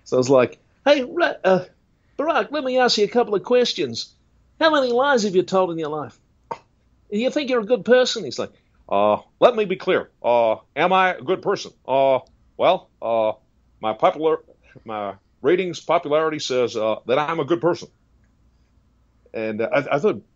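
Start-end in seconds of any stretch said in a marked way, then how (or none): 0.8-8.2 much higher-pitched preacher impression; Ray Comfort. Evangelist quizzing Barack on the good person test, high put-on voice. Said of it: "doing Ray Comfort's voice is fun". 8.9-17.9 halting politician impression, raised pitch; Barack Obama. President answering the evangelist's test, halting politician voice. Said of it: "good person test with Barack Obama".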